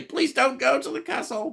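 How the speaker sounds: very high-pitched mock plea; hammed-up warning, squeaked high; pleading villagers. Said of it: "trying to say".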